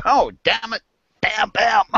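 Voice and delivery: squeaky farmer firing off the deck; mock annoyed farmer. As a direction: act out shooting from the deck, high and cartoonish. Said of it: "you'd come out on the deck and go".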